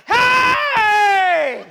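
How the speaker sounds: high, loud holler, easing slightly; urgent shouted prayer; desperate calling out. Shown as a demonstration. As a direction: grab God's attention with a long shouted cry. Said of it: "you gotta say".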